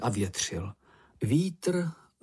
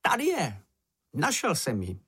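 high-pitched cry of discovery; excited cry; 4 semitones higher than usual. A narrator's reading. Narrated character announces he has found it, excited cry. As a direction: cry out on the find, starting high. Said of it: "nakonec vykřikl".

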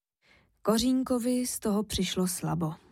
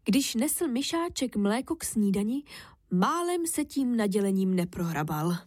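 plain reading voice

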